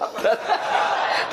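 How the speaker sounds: short breathy burst of laughter; a quick amused chuckle. Real, not acted. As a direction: laugh at your own joke. Preacher laughing at own joke.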